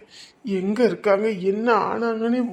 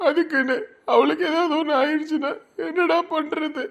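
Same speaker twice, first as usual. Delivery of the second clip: higher, tearful pleading that breaks into sobs; a husband weeping over his missing wife; crying; 5 semitones higher than usual. Acted. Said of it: "சின்ன பிள்ளை கணக்கா அழுதுட்டு இருக்க". (அழுகையுடன் உடைந்த குரலில்)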